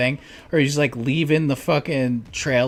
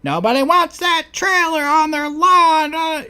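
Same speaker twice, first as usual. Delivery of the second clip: mocking nagging mom; 7 dB louder than usual. Retold mom yelling at son.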